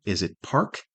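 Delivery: ordinary talk, nothing marked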